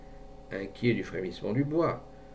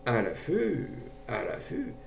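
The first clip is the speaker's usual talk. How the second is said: hesitant musing; slower than usual. A narrator's reading. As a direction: repeat it slowly to oneself, undecided. Gendarme hesitates, mulling it over.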